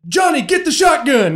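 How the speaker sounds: high-pitched, put-on holler; mock call for backup to frighten an intruder; scary threat. Bluffing to scare whoever made noise, high hollered threat. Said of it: "What's the scariest thing I could say?".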